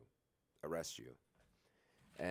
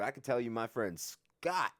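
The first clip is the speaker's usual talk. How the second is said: plain reading voice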